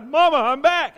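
the dead son shouting home to his mother, high and loud; joyful shout. Acted. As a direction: yell it out to your mama, overjoyed. Raised dead man calling mother.

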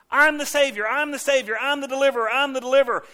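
God begging to be chosen; frantic God. Retold or imitated. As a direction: bounce it out like backflips.

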